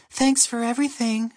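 very high cartoon bird voice; high-pitched grateful call; passing bird. A narrator's reading. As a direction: call out as a little admiring bird. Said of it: "another said as they passed".

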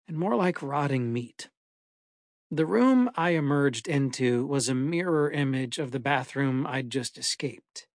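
ordinary delivery

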